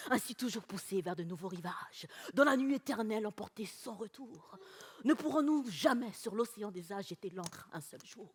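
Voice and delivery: low, soft recital of Romantic verse; poem recitation. Acted. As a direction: recite Lamartine low and softly.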